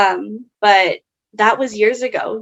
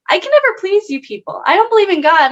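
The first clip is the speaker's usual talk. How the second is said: high, louder, fed-up retelling; exasperated outburst; 9 semitones higher, 4 dB louder, faster than usual. Retold or imitated. Retelling arguing with pro-choice friends, exasperated and higher. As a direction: throw your hands up at them, voice climbing. Exasperated at being called wrong.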